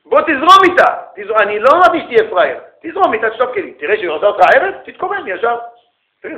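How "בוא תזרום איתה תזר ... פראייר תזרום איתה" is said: loud, high scolding retold to the class; retold rebuke. Rabbi retells rebuking student, loud and high. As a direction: scold him as you did then. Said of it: "אמרתי לו".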